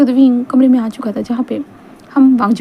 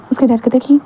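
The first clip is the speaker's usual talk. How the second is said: ordinary delivery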